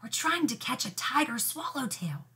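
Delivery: soft hushed plea; whispering. Acted. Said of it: "hissed Eugene".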